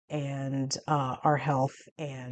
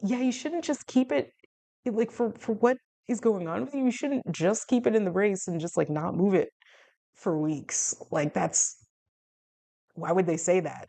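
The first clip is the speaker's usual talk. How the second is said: brother's reply; 5 semitones higher than usual. Retold or imitated. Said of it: "my brother was like".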